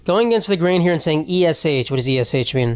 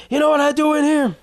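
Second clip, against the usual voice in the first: much higher put-on voice, a hammed-up tough inmate; scared-straight inmate; 11 semitones higher than usual. Pretending to be an inmate at scared straight, hammed-up menace. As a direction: play the menacing inmate scaring a kid, hammed up. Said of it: "pretend to be one of the inmates".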